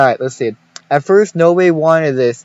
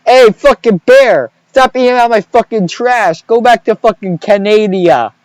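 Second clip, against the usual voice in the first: loud, higher mock Italian yell; 6 semitones higher, 5 dB louder than usual. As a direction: bellow at the bear like a hot-headed Italian. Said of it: "go in my Italian voice, start yelling".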